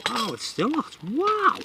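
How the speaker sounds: high startled wow at the heat; jolted high by the heat; surprised yelp. Real, not acted. Touching hot pan again.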